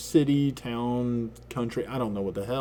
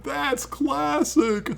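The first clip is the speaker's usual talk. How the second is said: slow, high, amused drawl; mock the friend's smug delight; Robert's amusement; 14 semitones higher, much slower than usual. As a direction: slowly savour it like a smug friend. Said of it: "here's Robert, like, going".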